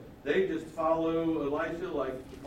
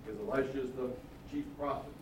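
usual speaking voice

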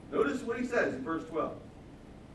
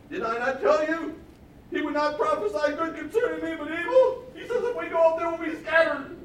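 loud, high, hammed-up complaint; whining king; 12 semitones higher, 8 dB louder than usual. King of Israel complaining to Jehoshaphat, mock wail. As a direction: protest at one high pitch like a sulking king. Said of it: "the king of Israel said to Jehoshaphat".

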